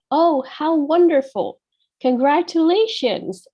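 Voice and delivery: light teacher demo of delight; mildly excited sample reply. Shown as a demonstration. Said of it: "we have to match their excitement".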